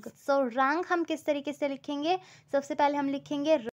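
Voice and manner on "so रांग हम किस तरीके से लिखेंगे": ordinary talk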